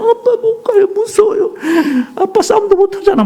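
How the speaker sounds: put-on very high, whining, sobbing little boy; crying child. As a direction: whimper like a son refusing to go on. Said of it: "계속 울면서 그냥".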